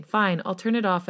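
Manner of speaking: usual speaking voice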